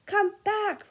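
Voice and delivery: high, loud yell after it; yelled after the car. Acted. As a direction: call the car back desperately. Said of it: "Ron yelled after it".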